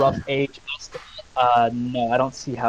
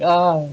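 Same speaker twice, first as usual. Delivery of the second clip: mock pained yelp; 4 semitones higher, 7 dB louder than usual. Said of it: "Sounded like you stubbed your toe".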